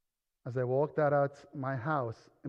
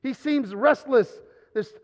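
high, loud, fervent sermon voice; preaching at full force; 8 semitones higher, 11 dB louder than usual. Acted. Preacher drives the point home to the congregation, loud and high.

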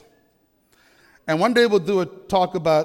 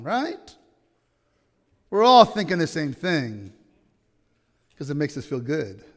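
usual speaking voice

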